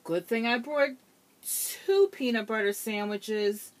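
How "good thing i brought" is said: usual speaking voice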